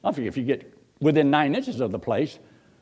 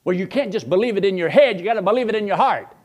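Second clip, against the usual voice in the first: ordinary talk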